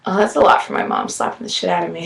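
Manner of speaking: usual speaking voice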